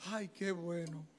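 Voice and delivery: soft, relieved sigh of words; quiet relief. Retold or imitated. A candidate relieved to be off the hook, softly. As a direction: murmur it to yourself, relieved. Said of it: "Y yo dije".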